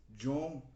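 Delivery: John with an English J, JON. Shown as a demonstration. Teacher comparing names across languages, English John. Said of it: "Em inglês".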